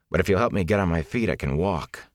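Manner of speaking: ordinary delivery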